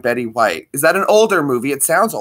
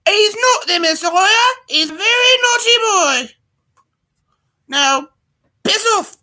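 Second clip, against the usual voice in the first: much higher, falsetto mock British mum; Python mother impression. Doing the Life of Brian mother for the stream viewers. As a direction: screech it like a scolding British mum in drag. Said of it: "Ash does it way better than I do".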